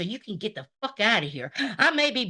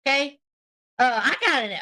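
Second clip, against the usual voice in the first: ordinary delivery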